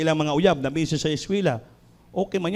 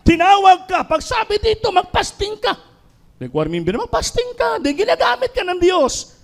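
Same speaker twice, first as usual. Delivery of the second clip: high, loud driving sermon; fiery preaching; 7 semitones higher, 5 dB louder than usual. Acted. Preacher scolding lazy choir members, loud and high. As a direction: hammer it home to the choir.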